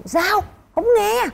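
high mock shout; mock teasing husband. Wife imitates husband teasingly pretending not to hear, high mock shout. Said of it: "ổng sẽ hỏi".

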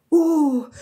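loud, high "Oh!"; startled cry. A narrator's reading. Somebody suddenly drops their flowers, startled cry. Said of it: "somebody cried".

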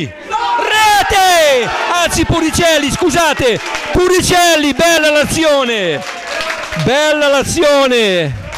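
high, shouted goal call, fading down; breathless italian goal commentary. Real, not acted. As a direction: scream the goal, then ride it out. Commentator calls third goal live. (urlo del gol concitato, poi sfumando)

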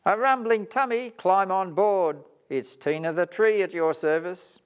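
high, loud, keen offer; happy to help, ready to go; eager helper. Acted. Tree eagerly offers help to Karen the koala.

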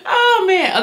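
high laughing oh man. Real, not acted. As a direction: laugh through the words. Laughing after the powder mishap.

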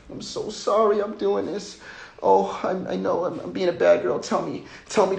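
put-on high, pleading girlish voice; imitating his partner. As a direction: plead up high like a naughty girlfriend. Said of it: "it's like".

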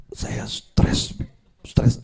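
breathy whispered whine; mock whiny complaint. Man moaning to the Habib about his stress, whispered and whiny. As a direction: moan it out like a tired petitioner.

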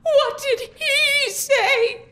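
melodramatic, quavering lament; tearful distress. Acted. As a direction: wail through anxious tears. Tearful mother awaits news of creditor.

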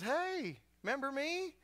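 high, bright call out; cheery greeting. Retold or imitated. Elkanah greeting the old priest, high and cheery. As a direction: hail him like an old friend. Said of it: "Elkanah is walking and says".

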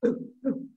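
short little ghost puffs; Baby Ghost oohs. Retold or imitated. Baby Ghost left in the house, short puffs. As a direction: puff out tiny oohs. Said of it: "Baby Ghost going".

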